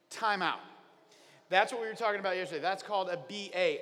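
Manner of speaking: usual speaking voice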